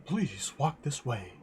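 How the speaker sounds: a touch of cold stiffness, slowed; lightly voiced cold guard; cold warrior voice. Ice warrior to gnome, a touch of chill. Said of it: "he said coldly".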